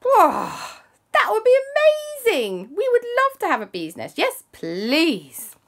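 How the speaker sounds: high, loud gushing delight; delighted Claire. Acted. Claire thrilled to get the bees, high and gushing. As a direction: squeal with joy at the offer. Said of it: "And Claire said".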